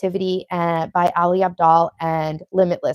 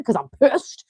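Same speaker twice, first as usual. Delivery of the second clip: hammed-up Long Island accent, building louder and higher; 6 semitones higher, 5 dB louder than usual. Shown as a demonstration. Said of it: "my Long Island accent coming out".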